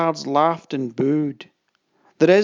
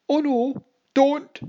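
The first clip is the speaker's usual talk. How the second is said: much higher, louder pleading cry; shouted plea; 11 semitones higher, 5 dB louder, slower than usual. Acted. Boy begs the captain not to fire, a high loud shout. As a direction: cry out to stop him. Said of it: "shouted the boy".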